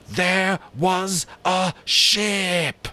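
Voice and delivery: high, very slow, loud accusing cry; loud and savage. Acted. Ralph silences the hunters with a savage cry. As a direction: strike them into silence. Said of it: "His voice was loud and savage".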